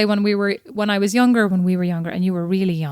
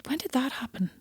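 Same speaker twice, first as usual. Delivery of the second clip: hushed, mortified whisper; embarrassed whisper; 2 semitones higher, 13 dB quieter than usual. Real, not acted. Embarrassed sister hushing the teasing, whispered. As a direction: whisper it, cringing.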